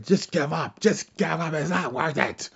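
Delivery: high-pitched, strained-sounding plea to quit; put-on high voice, urging surrender; Vince. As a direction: plead urgently in a put-on high voice. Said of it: "Vince's like".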